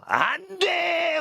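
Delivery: high, slow, drawn-out cry of refusal; emphatic prohibition. Acted. Preacher proclaiming each banned sin to the congregation, emphatic prohibition. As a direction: stretch the ban out at a high pitch.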